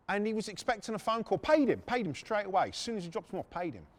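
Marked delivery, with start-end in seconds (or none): none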